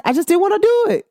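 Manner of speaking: high, breezy dismissal; blunt shrug-off. Retold or imitated. Brushing off someone asking why, breezy. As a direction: toss it off lightly, no excuses. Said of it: "you just be like".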